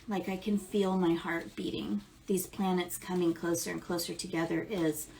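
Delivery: ordinary talk, nothing marked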